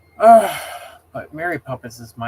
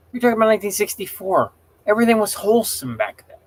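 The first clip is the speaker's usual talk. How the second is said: higher, louder and fast; heated rant; 7 semitones higher, 5 dB louder, faster than usual. Real, not acted. Streamer venting to chat that old films were wholesome, higher and loud. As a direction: fire it off in outrage. Angry rant about Mary Poppins.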